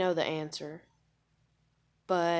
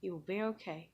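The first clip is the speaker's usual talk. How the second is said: calming self; 2 semitones higher, 6 dB quieter than usual. Retold or imitated. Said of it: "count to ten".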